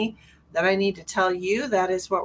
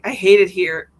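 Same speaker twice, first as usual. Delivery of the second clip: loud, blunt mock grumble; imitated frustrated complaint; 9 dB louder than usual. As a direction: retell an exasperated outburst. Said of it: "was actually having some difficulties".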